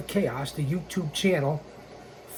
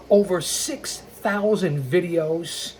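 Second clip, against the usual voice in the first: ordinary talk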